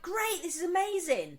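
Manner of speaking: lifted, pleased exclamation; retold delight. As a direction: recount it with upbeat amazement. Delighted reaction to working telly.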